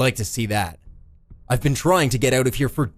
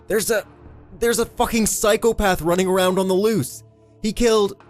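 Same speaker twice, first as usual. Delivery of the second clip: high, frantic warning; urgent yelling; 10 semitones higher, 3 dB louder than usual. A narrator's reading. Eddie warns the cop about a psychopath, urgent high yelling. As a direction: yell it out through a parched throat. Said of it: "Yelling shredded his arid throat".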